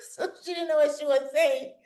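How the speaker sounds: high-pitched, laughing through the words. Real, not acted. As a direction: laugh while you tell it. Laughing at the language mix-up.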